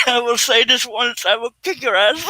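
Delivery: high-pitched, helpless laughing threat; cracking up mid-threat, voice shooting way up; losing it laughing. Real, not acted. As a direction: wheeze out the threat through real laughter. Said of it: "[name]'s losing it".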